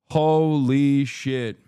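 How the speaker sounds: normal talking voice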